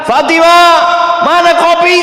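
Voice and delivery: loud, high holler for the wife; acted angry husband calling out; husband's yell. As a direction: shout across the house demanding service.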